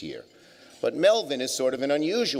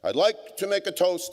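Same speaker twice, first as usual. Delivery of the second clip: put-on New York accent; 8 semitones higher than usual. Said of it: "He had a thick New York accent".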